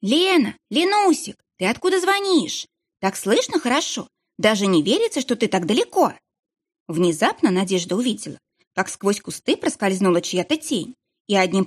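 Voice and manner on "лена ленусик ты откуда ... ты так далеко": high excited phone call; thrilled, calling out into a phone; взволнованно. Acted. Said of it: "взволнованно кричала в мобильник". (взволнованно, высоким голосом)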